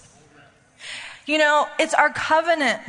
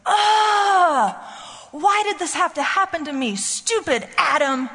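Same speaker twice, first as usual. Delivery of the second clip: put-on whiny teenage son; 5 semitones higher than usual. Mother imitating her thirteen-year-old complaining at breakfast, high whiny voice. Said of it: "and he goes".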